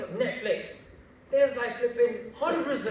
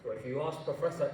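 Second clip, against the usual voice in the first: ordinary talk, nothing marked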